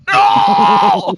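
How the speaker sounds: high, loud drawn-out "no"; mock scream of protest. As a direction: scream in pretend horror at being reprogrammed. Mock victim of brain reprogramming.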